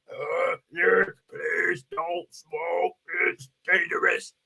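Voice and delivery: low, very slow, hoarse ad voice; cancer ad lady. Retold or imitated. Imitating the commercial lady again, low and very slow. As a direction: drag it out like the dying woman in the ad. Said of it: "Remember those ladies?".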